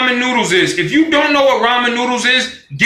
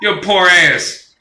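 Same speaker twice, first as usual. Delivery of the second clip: ordinary talk, nothing marked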